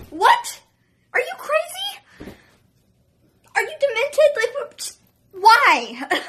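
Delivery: high, loud disbelieving shriek; hearing the crazy scooter plan, a high loud shocked outcry. Retold or imitated. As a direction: squeal it like the plan is insane.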